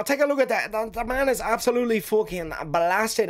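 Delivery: high, excited mock Irish accent; Conor McGregor impression. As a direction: ham up a hyped Irish fighter's brogue. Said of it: "because he's got an Irish accent".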